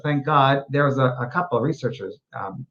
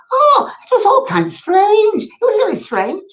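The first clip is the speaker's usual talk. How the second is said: Scottish woman; 5 dB louder than usual. Retold or imitated. Medium retelling a Scottish spirit, high Scottish lady voice. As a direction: play the old Scottish woman, bemused. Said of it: "it was a Scottish woman".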